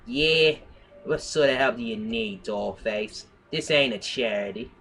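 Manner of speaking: tough noir detective in a bad Brooklyn accent; awful Brooklyn accent. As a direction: talk like a hard-boiled Brooklyn gumshoe. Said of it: "an awful Brooklyn accent".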